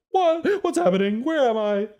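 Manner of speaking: high, loud, bewildered animal voice; confused camel voice. Acted. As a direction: squeak it out like a lost camel. The camel goes.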